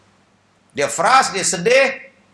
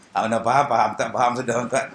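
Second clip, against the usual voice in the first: normal talking voice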